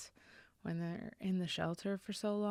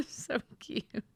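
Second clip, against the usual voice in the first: high, laughing coo over a cute dog; laugh, then gush through it; real giggle spilling into the words; delighted laugh; 9 semitones higher than usual. Real, not acted.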